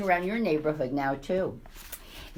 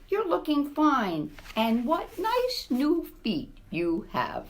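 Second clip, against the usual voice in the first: tender mother; 6 semitones higher, slower than usual. Acted. Mother greets son.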